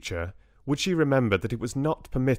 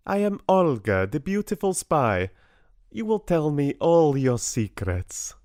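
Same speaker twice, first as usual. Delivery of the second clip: higher, hammed-up Russian spy accent; very bad Russian accent; 7 semitones higher, 4 dB louder than usual. A narrator's reading. Bella teases Richard as a spy, bad Russian accent. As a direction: play a seductive spy in fake Russian. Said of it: "very bad Russian accent".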